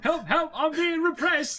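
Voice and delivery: very high, loud squeal; mock peasant cry. Friend as Dennis the peasant, high mock squeal. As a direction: squeal for help in mock outrage. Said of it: "Monty Python".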